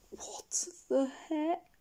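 slow unvoiced whisper of shock. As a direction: hiss it out in disbelief.

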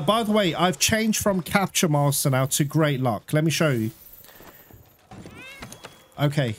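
ordinary delivery

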